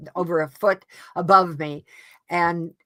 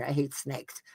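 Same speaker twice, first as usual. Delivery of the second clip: hushed; 4 semitones lower, 11 dB quieter than usual. Real, not acted. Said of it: "Oh my God".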